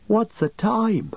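stretched-out, high yawning question; sleepy hobbit yawning the words. A narrator's reading. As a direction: ask it through a big yawn.

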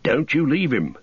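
lightly voiced firm warning, falling on leave; retold warning. Friends warning Sam to stay with Frodo, stern. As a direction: warn him not to leave his master. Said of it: "they said to me".

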